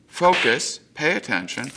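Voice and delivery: gentle coaxing, lightly voiced; a hint of a soothing guide. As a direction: settle him down. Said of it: "it just goes".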